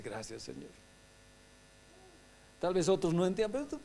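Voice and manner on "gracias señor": gracias suave. Shown as a demonstration. Ejemplo de oración agradecida.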